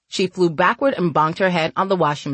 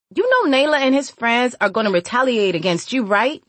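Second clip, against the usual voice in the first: put-on higher girlish caution; warning; 6 semitones higher than usual. A narrator's reading. Girl warns woman the boys will retaliate, cautioning. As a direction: warn her in a raised-pitch girl's voice. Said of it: "Cutie warned".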